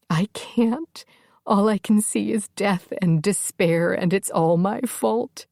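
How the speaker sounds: crying but trying to keep it hushed; tearful whisper. Acted. Crying, told to stay quiet.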